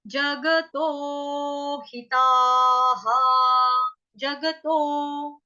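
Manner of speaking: जगतो हिताः stretched out, syllable by syllable; very slow. Shown as a demonstration. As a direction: draw it out for learners. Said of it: "teacher demos slowly". (बहुत धीरे धीरे)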